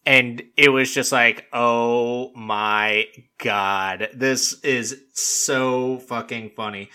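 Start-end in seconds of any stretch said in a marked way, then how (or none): 1.5-6.9 very slow, drawn-out amazement; stunned disbelief. Retold or imitated. Viewer watching him bolt over, stunned disbelief. Retold awe at absurd scene.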